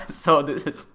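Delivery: words spilling out through a laugh. Real, not acted. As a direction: keep laughing as you talk. Laughing at own language list.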